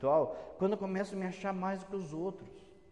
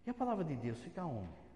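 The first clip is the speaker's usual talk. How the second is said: normal talking voice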